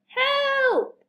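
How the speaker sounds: very high, long-held call; high-pitched plea, held out; yelled cry for help. A narrator's reading. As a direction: cry out in storybook alarm. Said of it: "yelled Harold".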